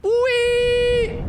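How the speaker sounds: high gleeful squeal into the pit; gleeful whee. Real, not acted. Jumping into bottomless pit.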